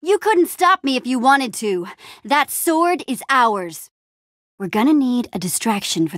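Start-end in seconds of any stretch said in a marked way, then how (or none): none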